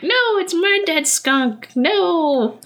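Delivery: high, slow squeaky bird bickering; squabbling raven voice. Retold or imitated. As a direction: voice a greedy raven. Ravens voiced fighting over skunk.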